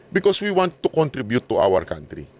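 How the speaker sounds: ordinary talk, nothing marked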